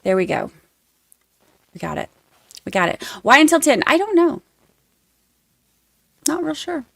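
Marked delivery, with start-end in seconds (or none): none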